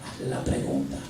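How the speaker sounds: voiceless whisper of the command; whispered inner voice. Retold or imitated. God's voice nudging the pastor again, whispered. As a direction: breathe the order in a whisper. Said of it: "esa voz espiritual me dice".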